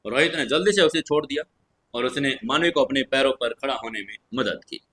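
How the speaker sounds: ordinary delivery, nothing marked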